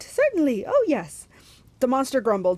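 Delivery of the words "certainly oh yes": loud, high falsetto agreement; eager put-on high voice; Grover. A narrator's reading. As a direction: reassure like a fake bride.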